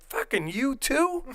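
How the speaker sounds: high, slow disbelieving squeal; high mock-outraged whine; mock outrage. Outraged reply, retold.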